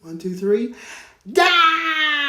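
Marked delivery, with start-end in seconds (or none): 1.3-2.2 much higher, louder scream of Down. Shown as a demonstration. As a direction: scream Down with the children. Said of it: "Scream it loud with me".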